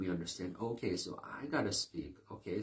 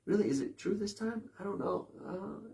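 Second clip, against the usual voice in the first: mock doubter; 12 semitones higher than usual. Doubtful listener voiced.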